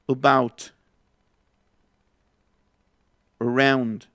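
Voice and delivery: "About. Around." with a weak neutral first vowel; isolated words, stress on the second syllable; schwa. Shown as a demonstration. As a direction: reduce the start of "About" and "Around" to "uh". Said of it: "donde sale la schwa".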